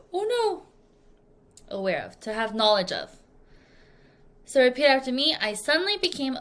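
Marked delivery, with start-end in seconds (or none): 0.1-1.8 much higher startled oh no; hammed-up dismay; mock alarm. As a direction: act suddenly realizing the time. Realizing overslept.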